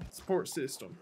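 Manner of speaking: ordinary delivery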